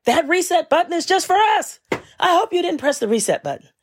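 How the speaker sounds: high, alarmed customer service voice; panicky agent. Retold or imitated. Agent panics at caller about the reset button, high alarm. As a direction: squeal it like a panicked tech. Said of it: "he was like".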